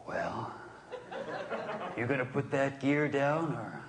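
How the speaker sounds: slow drawling tough-guy voice, lightly done; a hint of a squinting cowboy; Clint Eastwood impression. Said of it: "I'm turning into Clint Eastwood".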